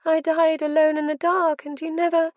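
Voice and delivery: rising anguish, cut off; anguished accusation. A narrator's reading. Mother's ghost accusing.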